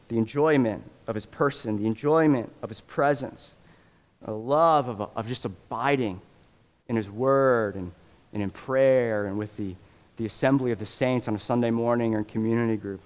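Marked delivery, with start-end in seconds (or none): none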